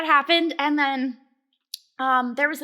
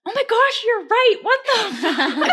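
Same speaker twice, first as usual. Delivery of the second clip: high, loud, laughing disbelief; shocked realization; 9 semitones higher, 7 dB louder, slower than usual. Retold or imitated. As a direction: relive it dawning on you, breaking into laughter.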